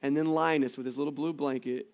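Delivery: ordinary talk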